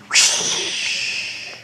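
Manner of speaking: breathy blown whoosh of wind; mommy imitating the wind; airy whoosh. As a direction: blow it like the wind. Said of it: "And Mommy said".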